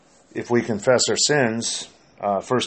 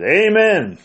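high, loud toddler's shout imitated; shouted amen; 11 semitones higher, 7 dB louder than usual. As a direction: belt it out like an interrupting two-year-old. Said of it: "Just shouted it out".

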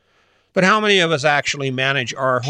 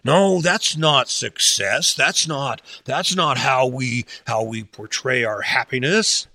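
slow, dismissive "no, no" of a doubter; mock resistance; much slower than usual. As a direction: brush the idea off slowly, like a stubborn skeptic. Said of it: "what the resistance is gonna be".

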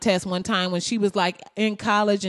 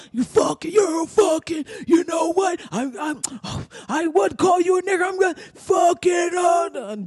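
high, spluttering mock shout; imitated enraged yelling; 9 semitones higher than usual. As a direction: sputter with hammed-up rage, words failing you. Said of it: "fucking yelling".

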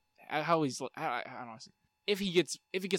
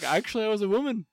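mock Korg reveal; 8 semitones higher, 6 dB louder than usual. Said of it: "To be like".

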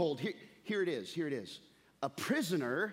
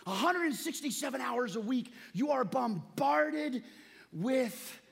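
preaching; 6 semitones higher than usual. Acted. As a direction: swell the sermon to a cry. Rising preaching climax.

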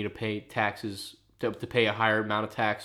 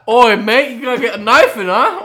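much louder and higher hammed-up cockney threat; mock British thug; 13 semitones higher, 15 dB louder than usual. Joking about sending him to England, in a shouted mock cockney accent. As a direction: bark it like a London street tough.

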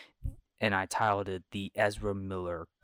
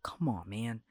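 pleading bodyguard; 5 semitones higher, 4 dB louder than usual. Retold or imitated. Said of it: "bodyguards who were with him were like".

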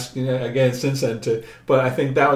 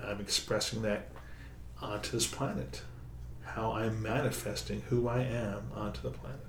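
soft guided relaxation; 8 dB quieter than usual. Acted. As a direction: ease them gently into the body. Sound healer guiding relaxation.

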